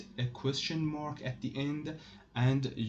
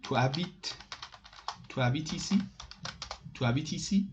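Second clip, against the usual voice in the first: "Tu habites ici?" climbing to a high "ici"; rising question intonation; 3 semitones higher, faster than usual. Shown as a demonstration. French teacher gives the familiar form, rising question intonation. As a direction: lift the end of "ici?" clearly. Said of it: "you will make your voice up".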